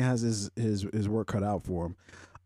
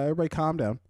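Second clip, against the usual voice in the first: ordinary talk, nothing marked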